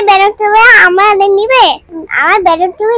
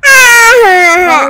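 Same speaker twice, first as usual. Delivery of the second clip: long, drawn-out wail; put-on childish sobbing "aha"; pretend crying; 4 dB louder, much slower than usual. Child whose balloon was taken, pretend crying wail. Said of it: "ময়না, তুমি কান্না করে না".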